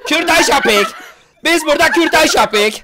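high, loud mock outrage; mock ranting shout. Ranting slogans to amuse friends, mock shout. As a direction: shout it like an over-the-top zealot.

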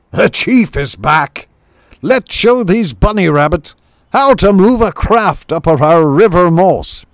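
high, loud rallying chief voice; shrew chief giving orders. Acted. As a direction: drive the crew on, loud and high. Chief rallying crew on ship.

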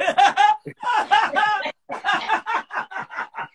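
host laughing hard at the silence; burst of laughter. Real, not acted. Host cracks up at the guest's sigh, cackling.

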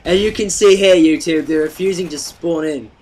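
high, loud mock nerd commentator voice; nerdy kid impression. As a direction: ham up the nerdy kid talking to his camera. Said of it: "he sounded so nerdy".

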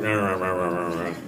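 low, muttered fake cussing; a hint of grumbling fake curses. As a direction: grumble nonsense swears under the breath.

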